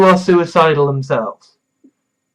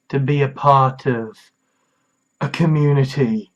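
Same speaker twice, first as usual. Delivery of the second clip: soft sincere; 5 semitones lower, 6 dB quieter than usual. Real, not acted. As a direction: speak gently to someone struggling.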